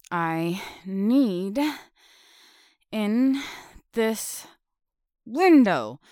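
pushing with effort; straining. Acted. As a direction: grunt the words out. Squeezing into the window.

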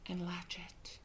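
hushed whispered aside. Acted. Bride locking the cage, whispering. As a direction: whisper it as the latch clicks.

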